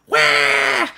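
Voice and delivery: loud, very high fake bawl; mock crying wail. She distracts him with tears, loud mock wail. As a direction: burst into a comic "wah" to distract. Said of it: "She starts crying to distract him".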